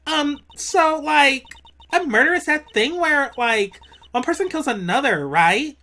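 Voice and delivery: put-on high, lilting Valley Girl; ditzy Valley Girl chatter. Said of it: "I gotta do a Valley Girl voice".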